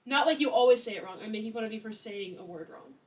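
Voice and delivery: ordinary delivery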